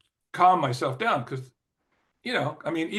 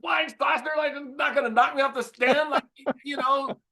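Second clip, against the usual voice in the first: much higher, louder mock-frantic rant; exasperated outburst; 5 dB louder than usual. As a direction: blow up at the lighting guy in a high strained yell. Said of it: "Yeah, like".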